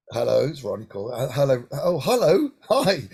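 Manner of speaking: a light sketch of the comedian on the phone; Ronnie Corbett impression.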